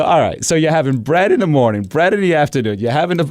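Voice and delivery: high, incredulous tallying; mock exasperation. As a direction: pile up the evidence comically. Son tallies up all the bread.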